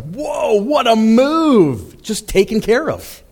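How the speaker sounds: high, slow amazed whoa; stunned. Retold or imitated. Comedian stunned the dinner bill is already paid, high and slow. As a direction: gasp it out in disbelief. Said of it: "he's, like, stunned".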